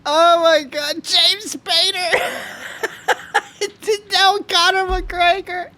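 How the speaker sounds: real helpless laughter, voice squeaking high; barely getting words out through a laughing fit; crying with laughter. Real, not acted. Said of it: "I'm crying".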